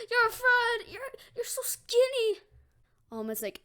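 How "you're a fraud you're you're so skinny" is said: high, loud acted disbelief; shocked outcry. Deku sees All Might's skinny form, shocked. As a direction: cry out the accusation in shock.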